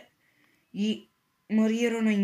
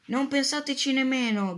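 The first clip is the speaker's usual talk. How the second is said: higher, louder stern shout; teacher shouting; 5 semitones higher, 4 dB louder than usual. A narrator's reading. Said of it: "gridò la professoressa Magli". (la prof grida forte e severa)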